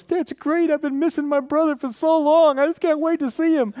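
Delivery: high-pitched, gushing fake delight; hammed-up, high-pitched joy at a brother's return; mock delighted brother. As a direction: put on an over-the-top thrilled voice. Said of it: "Is that how it went?".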